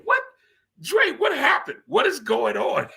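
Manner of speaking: high, laughing mock-alarm; laughing at his own crying; laughing disbelief. Real, not acted. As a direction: ask it while laughing, high up. Teasing himself, laughing.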